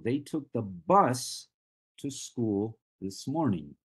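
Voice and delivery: highlight "bus" and break after it; stress on BUS. Shown as a demonstration. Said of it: "I am stressing now the words".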